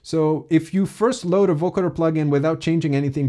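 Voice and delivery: ordinary talk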